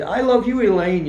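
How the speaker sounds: gushing imitation. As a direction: mimic clients hugging Elaine, overdoing the warmth. Church friends greeting Elaine.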